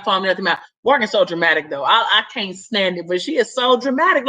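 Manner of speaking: ordinary talk, nothing marked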